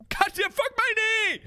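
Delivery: high, slow, drawn-out howl; exaggerated agonized yell; hammed-up pain yell. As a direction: cry out over the knee. Kicked in the knee.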